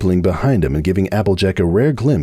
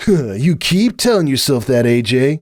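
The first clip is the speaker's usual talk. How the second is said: slow, raised, smug stallion voice; smug retort; 5 semitones higher, slower than usual. A narrator's reading. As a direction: drawl it out, full of himself. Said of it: "he said".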